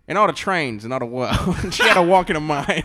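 mock noir narrator. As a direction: play at narrating a noir romance. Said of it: "A noir thing".

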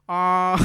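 retold shout. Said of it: "He went".

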